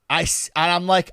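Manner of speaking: ordinary talk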